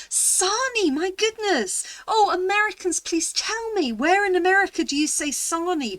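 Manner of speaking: high, loud, delighted disbelief; astonished. Real, not acted. Amazed Brit asking Americans about her dad's word, astonished. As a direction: burst out amazed and plead for an answer.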